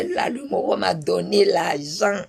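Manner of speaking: normal talking voice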